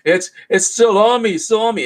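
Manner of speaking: hammed-up desperation; a touch of hysteria; mock panic. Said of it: "he's like".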